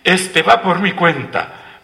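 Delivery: high, loud and stretched out; emphatic interjection. A narrator's reading. Don Pedro cuts in to pay for the barrel, calling out. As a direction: cut in loudly to claim the round. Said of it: "lo atajó don Pedro Moreno".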